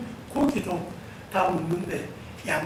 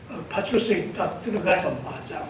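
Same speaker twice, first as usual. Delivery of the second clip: usual speaking voice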